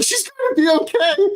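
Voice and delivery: put-on a quick high-pitched reassuring girl's voice; Wendy's gentle voice. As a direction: squeak it out like a little girl healer. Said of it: "I hear Wendy's voice".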